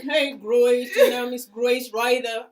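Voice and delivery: loud drawled greeting, slow; heavy drawl. Retold or imitated. Imitating the drawling interviewer, loud and slow. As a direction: stretch it out in a thick drawl. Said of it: "The person was like".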